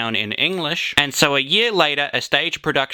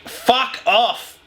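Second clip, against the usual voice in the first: mock angry; 9 semitones higher than usual. Angry dismissal of ad.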